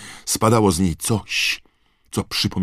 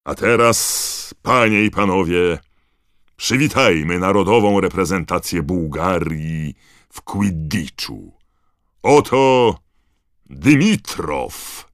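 loud, raised, showy introduction; stadium announcer; 3 semitones higher, 6 dB louder than usual. A narrator's reading. Bagman presenting the Bulgarian team, grand announcer's call. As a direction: project grandly, stretching the big words.

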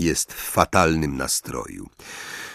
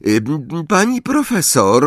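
timid Parvati; 9 semitones higher than usual. A narrator's reading. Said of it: "cichym głosem Parvati Patil".

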